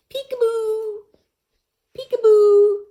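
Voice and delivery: high, loud squeal of peekaboo; playful peekaboo. Pretend. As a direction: surprise the kids with a shrieking peekaboo. Playful peekaboo call to children.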